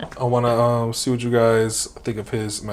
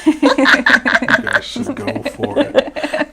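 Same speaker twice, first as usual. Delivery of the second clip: laughing; 11 semitones higher, 6 dB louder, much slower than usual. Real, not acted. Laughing at the howling idea.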